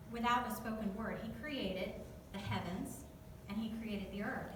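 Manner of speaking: ordinary talk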